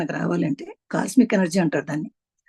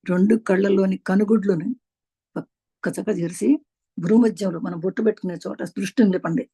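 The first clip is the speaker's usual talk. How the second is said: ordinary talk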